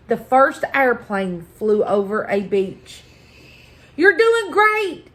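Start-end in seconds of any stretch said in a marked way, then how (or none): 4.0-5.1 high-pitched cheering on; encouraging. A narrator's reading. Moon to the first airplane over a beach, encouraging. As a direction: cheer them on, pitch lifted. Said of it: "encouraged the moon".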